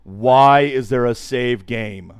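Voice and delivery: slow and loud, stretched out; drawn-out loud wail. Real, not acted. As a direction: drag every word in disbelief. Repeats question, drawn out.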